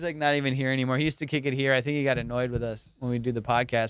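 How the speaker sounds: normal talking voice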